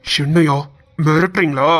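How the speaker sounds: low, menacing confrontation; angry challenge. Acted. Young man confronts the bank's collectors. (கோபமாக தாழ்ந்த குரலில் சவாலாக)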